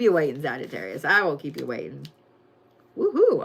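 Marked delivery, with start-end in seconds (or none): 3.0-3.4 little cheer. Real, not acted. Cheering for the sign.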